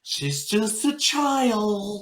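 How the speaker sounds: high, drawn-out mock wail; mock distressed parent. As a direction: stretch it out like a hammed-up protest. Hammed-up guess at parent's reply.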